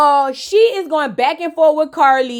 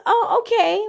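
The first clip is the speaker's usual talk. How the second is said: mock unimpressed; 7 semitones higher than usual. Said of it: "She's like".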